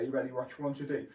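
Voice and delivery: usual speaking voice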